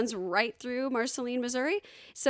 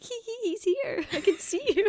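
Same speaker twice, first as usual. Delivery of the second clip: high, giggly squeal; giddy secret giggle; 9 semitones higher than usual. Retold or imitated. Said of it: "giggling to myself".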